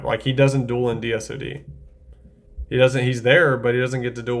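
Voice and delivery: ordinary talk, nothing marked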